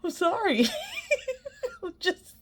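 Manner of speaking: high, giggly apology; words squeezed out between giggles; real giggling. Real, not acted. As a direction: apologize through a fit of giggles. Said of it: "I'm now giggling".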